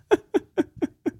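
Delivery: a short high burst of laughter. Real, not acted. Comic laughing at the clueless man, high laugh.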